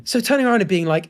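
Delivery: ordinary delivery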